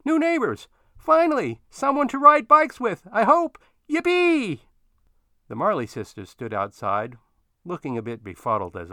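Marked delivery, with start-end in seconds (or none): 0.0-4.6 much higher, loud gleeful yells; child's high joyful yelling; excited yelling. A narrator's reading. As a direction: shout it out riding away on a bike.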